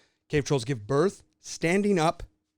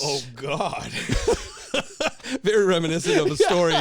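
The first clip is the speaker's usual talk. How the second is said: laughing; 6 semitones higher, 5 dB louder than usual. Real, not acted. Cracking up at Joker backstory.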